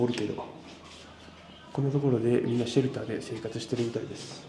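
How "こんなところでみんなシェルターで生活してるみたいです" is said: usual speaking voice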